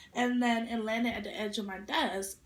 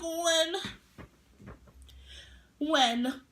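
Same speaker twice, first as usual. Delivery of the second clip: challenge; 5 semitones higher, 3 dB louder than usual. Retold or imitated. Daring classmate to fight.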